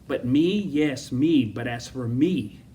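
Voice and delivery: heavy stress on "me", slowing down; emphatic. Acted. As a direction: hit each "me" hard, drawing out the last phrase. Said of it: "it's a very emphatic way of saying".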